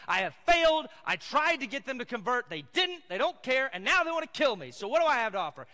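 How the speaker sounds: high, loud voice of a defeated prophet; exasperated outcry. Acted. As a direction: cry it out as a desperate complaint to God. Elijah's despairing complaint acted out.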